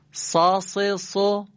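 سا سه سو with a heavy emphatic ص; emphatic Sad. Shown as a demonstration. Teacher drilling students on the Arabic letter ص. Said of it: "گوش بدید و جواب بدید".

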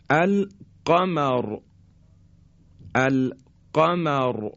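القمر with thick final ra, slow; heavy ra. Shown as a demonstration. Teacher reciting القمر example slowly. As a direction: draw out القمر with emphatic ra. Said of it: "مثل".

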